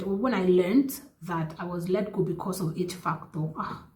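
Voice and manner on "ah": ordinary delivery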